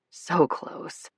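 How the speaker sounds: breathy whisper under the breath. A narrator's reading. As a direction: murmur it as a private longing. Inner thought, breathless whisper.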